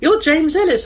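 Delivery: gushing mum greeting. Retold or imitated.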